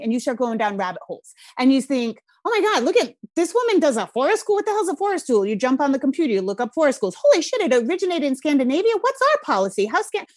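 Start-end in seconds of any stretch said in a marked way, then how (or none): 2.5-5.4 excited. Retold or imitated. Retelling excited discovery while scrolling. 7.2-10.2 excited. Retold or imitated. Retelling excited rabbit-hole discovery.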